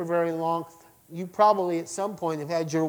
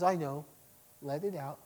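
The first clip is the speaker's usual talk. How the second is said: gentle father; 2 semitones lower, 8 dB quieter than usual. Acted. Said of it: "the Father just sits there and says".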